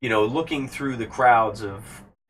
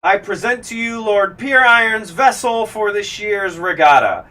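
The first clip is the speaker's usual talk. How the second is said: high, loud proclamation to the crowd; grand announcement; 10 semitones higher, 8 dB louder than usual. Acted. Elf herald announcing the vessel to the dockside crowd, high and loud. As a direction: announce it like a herald with arms raised. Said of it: "raises her arms and she says".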